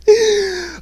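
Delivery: high, drawn-out wheezing laugh; helpless laugh. Real, not acted. Cracks up at absurd casting.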